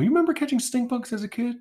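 quoting himself asking. Retold or imitated. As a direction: quiz people eagerly. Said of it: "I like to ask people".

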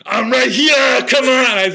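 loud, high Austrian shout, laughing at the end; Arnold voice. Retold or imitated. Wizard actor booms Arnold at the children, shouting in Austrian accent. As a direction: boom it out like Arnold to scare. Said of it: "I'm gonna do the Arnold".

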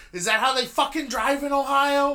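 imitating a furious state trooper; trooper yelling. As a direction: bark it like an angry cop chewing someone out. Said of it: "he let off again with the".

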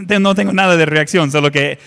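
normal talking voice

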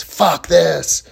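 retold furious yell, hammed up; imitated screaming. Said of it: "He starts screaming at the top of his lungs".

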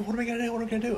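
high, anxious repeated question; fretting helplessly in a high voice; panicked whine. Retold or imitated. Stranded soldier asking himself what now, high anxious whine. Stuck after the airstrip is blown up.

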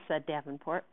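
usual speaking voice

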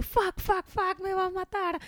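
high, panicky swearing under breath; mock panic at the burnt pizza; frightened worker after burning the pizza; panicked.

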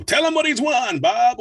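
big booming game show announcer. Shown as a demonstration. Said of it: "announcer voices".

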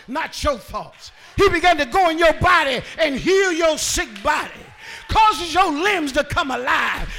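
loud, driving sermon shout; rousing Pentecostal exhortation; full-force preaching. Real, not acted.

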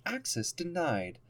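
flat monotone robot; deadpan computer voice. Retold or imitated. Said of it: "my monotone robot voice".

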